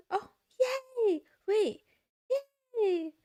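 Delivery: high, loud squeal of delight; squealing yay. Real, not acted. Watching a show, squealing with delight at the couple. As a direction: gush at the couple on screen.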